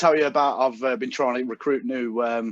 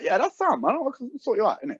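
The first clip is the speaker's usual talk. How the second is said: put-on high-pitched phone salesman accent; Aaron's accent; 10 semitones higher than usual. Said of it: "I'm not exaggerating this accent".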